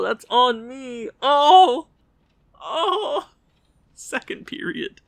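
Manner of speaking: ordinary talk, nothing marked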